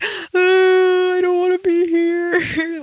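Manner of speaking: high, loud mock whine. Vlogger retelling post-surgery misery, high loud whine. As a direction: wail it like a miserable patient. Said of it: "I literally was like".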